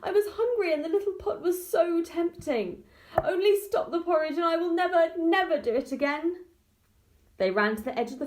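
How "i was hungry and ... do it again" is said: mother pleads with her daughter to stop the pot; tearful. A narrator's reading. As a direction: beg forgiveness through tears. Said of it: "said the mother tearfully".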